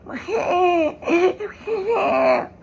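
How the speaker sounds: high wailing infant cry; imitated baby cry. Retelling the film's ending, a high imitated baby wail. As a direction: wail like a newborn. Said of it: "a baby crying".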